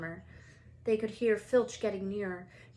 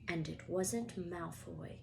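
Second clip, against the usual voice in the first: usual speaking voice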